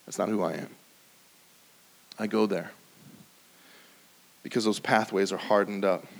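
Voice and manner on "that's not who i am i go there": low, quiet, muttered refusal; sullen refusal. Retold or imitated. Believer brushing God off, low and quiet. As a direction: shrug it off under your breath.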